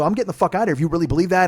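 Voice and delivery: rapid, loud, high threat to leave; fast angry shout. Retold or imitated. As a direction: spit it out yelling. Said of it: "and I start yelling".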